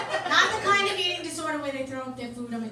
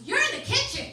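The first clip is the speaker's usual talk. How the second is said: put-on snotty teen retort; 9 semitones higher, 5 dB louder than usual. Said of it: "She goes".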